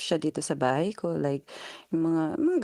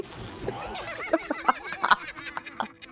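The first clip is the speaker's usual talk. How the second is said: high laugh; 5 dB quieter than usual. Real, not acted. Laughing at English struggle.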